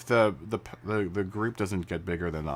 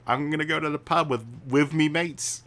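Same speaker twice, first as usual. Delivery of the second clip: higher-pitched mock London lad, th as v; London accent; 8 semitones higher than usual. Shown as a demonstration. Streamer imitating Londoners off to the pub, mock London accent. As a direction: put on a playful southern London accent. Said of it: "they don't pronounce their T H".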